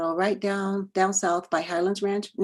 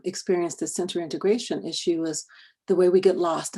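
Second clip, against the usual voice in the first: ordinary talk, nothing marked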